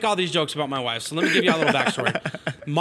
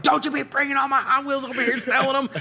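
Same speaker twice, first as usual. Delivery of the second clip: mock indignant husband, pitched higher; hammed-up outraged husband, higher voice; 9 semitones higher than usual. Husband scolding wife over his Hot Wheels, mock outraged.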